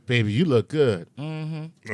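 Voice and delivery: mm-hmm lifted with admiring approval; affirming mm-hmm. Shown as a demonstration. Chuck showing an admiring mm-hmm to a man who looks good. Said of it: "So there's the affirmation".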